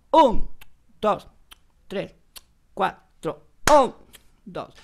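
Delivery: "Un" marcado fuerte y agudo, los demás suaves; acento en el uno. Shown as a demonstration. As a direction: golpea el "Un" y deja caer dos, tres, cuatro. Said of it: "el acento en el tiempo uno". (cuenta marcando el "Un" como tiempo fuerte)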